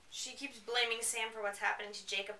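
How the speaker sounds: ordinary talk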